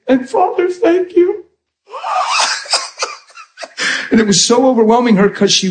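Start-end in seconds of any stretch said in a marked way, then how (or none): 0.1-1.5 very high, tearful squeak; imitated crying. Crying woman repeating the minister's prayer, imitated in a high tearful voice. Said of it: "Now she's crying". 1.9-3.1 very high mock sobbing; wordless high-pitched bawl, acted out; imitated bawling. Overwhelmed woman breaking down after praying, imitated high sobs. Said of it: "she's just bawling and bawling".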